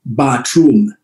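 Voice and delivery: lightly voiced Jamaican lilt; Jamaican accent. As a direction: imitate the father's Jamaican accent, one word. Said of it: "in his Jamaican accent".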